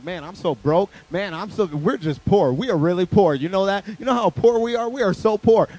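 mock complaining. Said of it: "being like".

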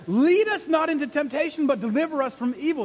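loud, high, urgent pleading; praying like a street fight; fighting prayer. Acted. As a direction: plead with God like in a battle. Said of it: "This isn't a mamsy-pamsy prayer".

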